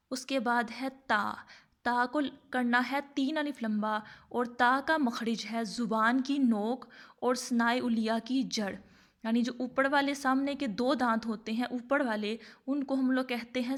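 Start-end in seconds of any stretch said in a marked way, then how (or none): none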